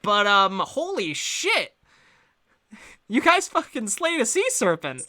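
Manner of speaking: high, slow holy shit; amazed. Acted. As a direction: explode with awe. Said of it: "pats you all on the back and says".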